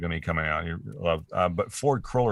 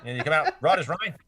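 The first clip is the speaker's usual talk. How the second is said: higher, laughing mock Australian accent; a laid-back Aussie shrugging off a sting; 8 semitones higher than usual. Mocking how tough Aussies are in a laughing Australian accent. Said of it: "the Aussie accent".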